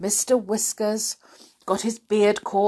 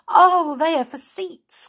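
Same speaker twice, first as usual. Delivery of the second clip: excited cry; 9 semitones higher, 5 dB louder than usual. Acted. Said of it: "cried Silky".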